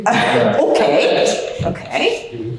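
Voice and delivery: okay said through breathy laughter; real laughing. Real, not acted. Workshop leader reacts to participant finding the horns, laughing. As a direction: laugh helplessly while acknowledging the joke.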